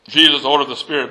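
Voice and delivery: ordinary delivery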